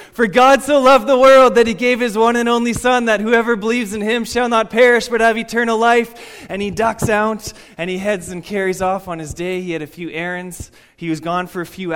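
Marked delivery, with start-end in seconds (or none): 0.1-6.5 shouted at full voice, high and loud; yelled verse. Retold or imitated. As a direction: belt it out across the square. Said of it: "he gets and he says".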